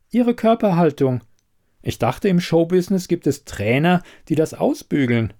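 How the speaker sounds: a hint of a knowing investigator; lightly voiced shrewd investigator. Elida probing the quizmaster about the candidate, lightly voiced shrewdness.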